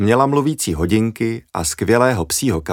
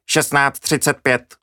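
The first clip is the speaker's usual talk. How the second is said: slow, even machine voice announcing time; talking watch voice; 5 semitones higher, slower than usual. A narrator's reading. The blind girl's talking watch announces the time, slow and mechanical. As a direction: read the numbers like a wristwatch speaking. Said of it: "ozvalo se".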